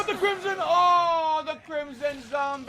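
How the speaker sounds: high, loud terrified yell; panicked shriek. Real, not acted. Zombie attacks, panicked scream.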